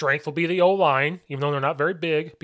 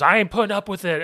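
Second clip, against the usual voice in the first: raised, louder voice of an irritated official; fed-up ref; 7 semitones higher, 5 dB louder than usual. Retold or imitated. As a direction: imitate a ref who has had enough. Retold angry ref confronting coach.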